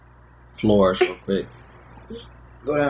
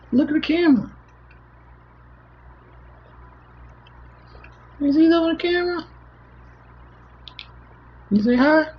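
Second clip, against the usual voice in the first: put-on high sing-song baby talk; 9 semitones higher, 6 dB louder than usual. Dad cooing at his baby daughter on stream, high baby talk. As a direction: coo at the baby like she just woke.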